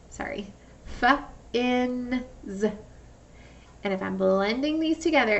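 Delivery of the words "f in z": f, in, z split into separate sounds. Shown as a demonstration. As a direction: break fins into its sounds. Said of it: "So f".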